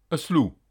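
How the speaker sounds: "eslou" with S straight into L; silent H. Shown as a demonstration. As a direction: drop any H in "eslou". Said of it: "le H n'est pas aspiré". (« eslou » sans H aspiré)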